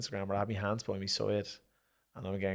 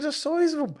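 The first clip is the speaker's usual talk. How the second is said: very high and louder, a hammed-up gasp; imitating Eddie sizing up the opponent; mock awe; 9 dB louder than usual. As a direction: squeak it out in mock wonder. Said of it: "He said".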